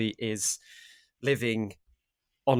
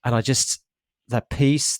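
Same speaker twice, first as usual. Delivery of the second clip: ordinary talk, nothing marked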